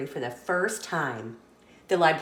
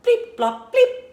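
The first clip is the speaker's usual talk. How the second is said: much higher and louder beeping robot voice; Robot Pete; 8 dB louder than usual. Acted. Robot Pete at the robot dance party, beeping. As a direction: chirp it out like a toy robot, rising to a squeak. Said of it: "When Robot Pete speaks".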